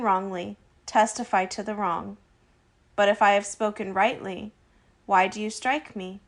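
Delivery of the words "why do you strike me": normal talking voice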